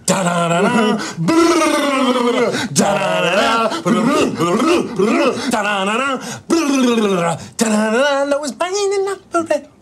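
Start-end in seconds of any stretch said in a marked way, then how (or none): 0.1-8.2 drum imitation. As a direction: mouth drum fill, high and rolled. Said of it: "What we did".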